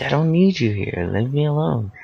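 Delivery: high-pitched mock child tantrum; acted high, whiny yell; retold kid yelling. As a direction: imitate a little kid shooing parents off. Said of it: "I'm yelling at them".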